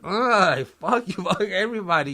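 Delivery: loud, high defiant shout with a laugh; defiant yell. Retold or imitated. Patient refusing help onto the surgery bed, defiant laughing shout. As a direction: throw it at everyone, fed up. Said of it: "I was really on it".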